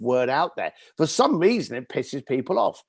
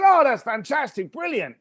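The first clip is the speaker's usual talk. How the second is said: high gushing praise; feigned delight; 10 semitones higher, 4 dB louder than usual. Retold or imitated. Speaker feigning delight at the caller's request, gushing. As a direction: lay the enthusiasm on thick.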